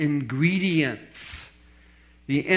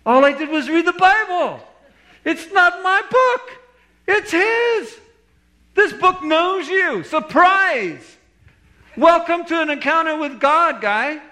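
loud, high-pitched comeback in punchy bursts; emphatic retort; 13 semitones higher, 5 dB louder than usual. Retold or imitated. Pastor answering his accuser about the Bible, emphatic retort. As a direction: fire back in acted exasperation, voice pitched high. Retold retort to the angry man.

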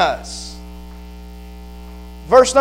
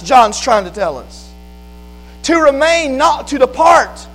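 higher-pitched, driving pulpit delivery; impassioned preaching; 7 semitones higher, 5 dB louder than usual. Real, not acted. Preacher teaching the congregation what "dwell" means, impassioned preaching. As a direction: press each point home in a raised preacher's pitch.

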